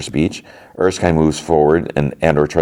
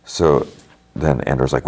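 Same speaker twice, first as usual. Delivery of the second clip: ordinary talk, nothing marked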